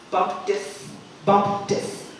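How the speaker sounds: "Bump this" for "bumped his", t joined on; deliberate "Bump this", final t carried into "his"; linked sounds. Shown as a demonstration. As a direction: link it crisply as "bump-tis". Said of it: "I will connect it together".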